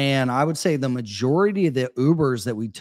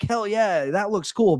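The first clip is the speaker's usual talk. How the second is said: higher-pitched, keen quoted reaction; mock excitement; 7 semitones higher than usual. Recalling the first announcement, mildly excited. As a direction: act out being impressed, lightly. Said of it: "I was like".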